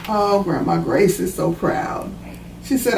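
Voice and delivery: usual speaking voice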